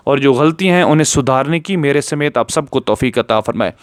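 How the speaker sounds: usual speaking voice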